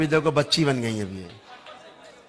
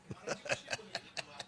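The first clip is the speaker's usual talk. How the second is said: short wordless laugh; 21 dB quieter than usual. Real, not acted. Healer who says he will not speak, laughing. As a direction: laugh it off without words.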